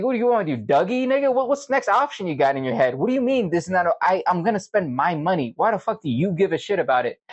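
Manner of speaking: high-pitched, exasperated comeback; indignant retort. Retold or imitated. Answering the coworker's speech about his own money, indignant. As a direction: fire back in retold disbelief.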